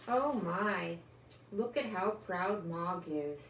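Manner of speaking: ordinary delivery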